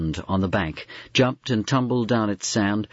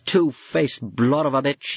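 angry father yelling at a fleeing man; yelled; 6 semitones higher than usual. A narrator's reading. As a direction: bellow the insult after him. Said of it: "he yelled".

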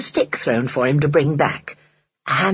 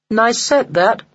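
mildly critical, higher-pitched remark; critical appraisal; 5 semitones higher, slower than usual. A narrator's reading. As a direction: size it up like a critic. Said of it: "said George critically".

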